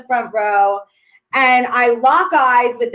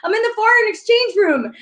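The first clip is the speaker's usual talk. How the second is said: panicked plea; 11 semitones higher, 3 dB louder than usual. Retold or imitated. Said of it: "I was like".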